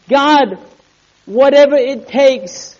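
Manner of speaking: high, forceful plea in prayer; crying out to God. Acted. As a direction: cry it upward, drawing out the words. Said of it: "when I cry out to God".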